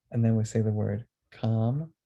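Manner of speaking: ordinary delivery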